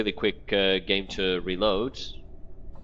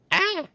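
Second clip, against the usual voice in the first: sudden high startled cry; startled shriek; 6 dB louder than usual. Acted. Marta caught at the corpse, a high startled shriek. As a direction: yelp as if caught in the act.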